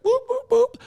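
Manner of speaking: very high playful boop boop boop; sneaky boop noises. Retold or imitated. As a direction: tiptoe over with squeaky boops. Sneaking over to dish the tea.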